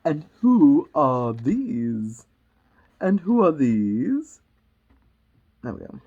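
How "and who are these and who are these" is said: slow, loud regal demand; imperious Queen. Acted. Queen pointing at the gardeners, slow and imperious. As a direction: draw each word out loudly. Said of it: "said the Queen".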